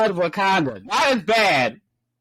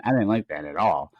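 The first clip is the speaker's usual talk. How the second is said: ordinary talk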